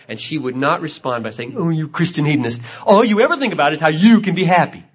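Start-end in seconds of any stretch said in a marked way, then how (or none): 1.6-4.8 higher, louder, fast teasing scold with a laugh; mock scolding wife. Wife teasingly scolds her husband, higher and louder with a laugh. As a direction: tease him as a laughing wife would.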